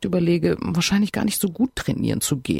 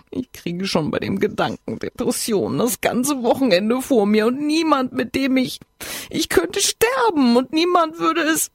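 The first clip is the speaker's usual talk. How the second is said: higher, voice climbing in despair; despairing whine; 7 semitones higher than usual. Acted. Friend despairs on the phone about being alone, whiny wail. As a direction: let the self-pity rise to a wail.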